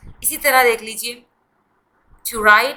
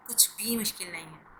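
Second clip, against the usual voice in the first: whisper; 3 semitones lower, 14 dB quieter than usual. Acted. Teacher reassures: nothing hard here.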